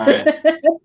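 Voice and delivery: loud, high burst of laughter; real amused laugh, high and loud. Real, not acted. Amused by octopus joke.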